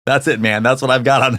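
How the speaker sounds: ordinary delivery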